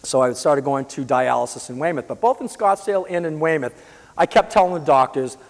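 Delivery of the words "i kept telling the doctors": ordinary delivery, nothing marked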